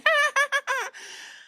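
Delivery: shrill, hammed-up cackle; high mocking laugh. The host laughing at the Asuka impression, high and mocking.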